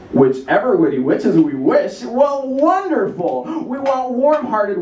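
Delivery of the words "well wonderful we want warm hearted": delighted. Acted. Delighted exclamation.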